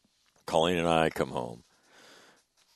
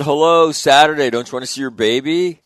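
obvious answer; 9 semitones higher, 11 dB louder than usual. Retold or imitated. Telling cousin obvious answer.

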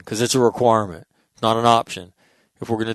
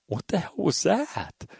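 high, breathy disbelief at the throw; what on earth was that, jaw dropped; stunned disbelief; 9 semitones higher, 5 dB quieter than usual. Retold or imitated. Crowd reacting to the quarterback's pass, stunned. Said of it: "And everybody's like".

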